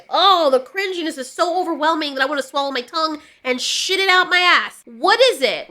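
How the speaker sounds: ordinary talk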